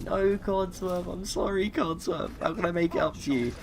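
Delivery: pleading apology. Pretend. Begging robot's forgiveness.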